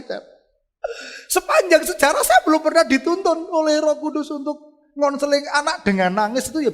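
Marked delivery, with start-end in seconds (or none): 1.3-6.7 high, loud preacher's exclamation; impassioned testimony. Real, not acted. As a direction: cry it out to the congregation. Excited testimony to congregation.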